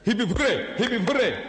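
quick rousing hooray; mock cheer. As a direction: cheer it like a hurrah. Mock cheer as suggested meaning.

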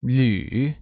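teacher's drawn-out 旅 with a dip-and-rise tone; third tone. Shown as a demonstration. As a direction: let 旅 sink and climb back up. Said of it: "pronounced using the third tone".

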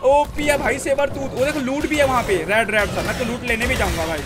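ordinary talk

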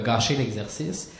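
normal talking voice

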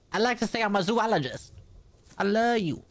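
voicing a cow in a squeaky put-on voice.

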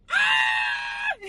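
high loud shriek of joy; joyful scream. Real, not acted. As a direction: scream with delight. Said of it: "acting a fool".

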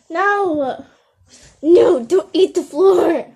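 loud, slow no, stop that; scolding shout. Real, not acted. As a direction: yell at the puppy to quit. Scolding puppy eating floor.

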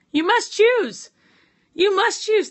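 high, loud grand proclamation; booming voice. Pretend. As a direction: announce it like a god. Booming heaven voice.

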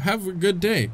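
ordinary talk

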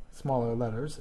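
usual speaking voice

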